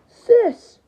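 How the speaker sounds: high, loud sharp call. Acted. Sibling calls to sister, high loud cry.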